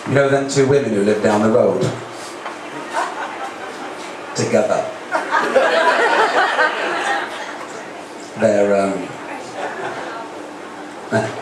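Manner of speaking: hushed gossip, trailing off; imitating mother. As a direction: mum mouthing the word. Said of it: "she'd say things like".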